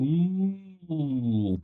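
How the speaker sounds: long, high gliding "ooh"; drawn-out ooh. Real, not acted. Bettor mulling over a tricky pick, drawn-out ooh. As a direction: let a high ooh swell and slide down. Reacts while weighing up the bet.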